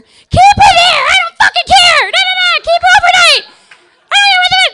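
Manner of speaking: very high, very loud shrieking rant; screaming mother. Retold or imitated. Mom to the cops on the phone, screaming. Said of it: "she's still screaming on the other line".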